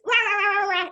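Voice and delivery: high nagging rah rah; mom voice. Retold or imitated. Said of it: "She has her mom voice".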